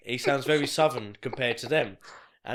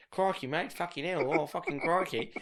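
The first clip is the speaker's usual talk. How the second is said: lightly put-on Aussie accent, pitched up; higher-pitched joke Australian; mock Australian; 4 semitones higher than usual. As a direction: imitate a silly Australian accent. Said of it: "like, Australian".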